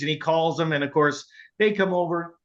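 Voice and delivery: usual speaking voice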